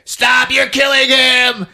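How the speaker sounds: high-pitched pleading shout; hammed-up desperate cry; Alice. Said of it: "Alice is like".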